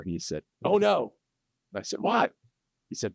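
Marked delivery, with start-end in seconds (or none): 0.6-1.1 high, louder panicked exclamation; mock terror. Husband caught showing his house to the realtor, mock terror. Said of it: "the look in his face of terror". 2.0-2.3 high-pitched exclamation; startled question. Retold or imitated. Realtor reacting to the husband's panic, startled.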